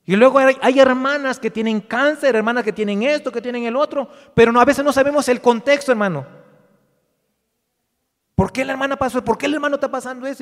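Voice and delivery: loud, high, urgent pulpit delivery; forceful preaching. Real, not acted. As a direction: press the point on the congregation loudly.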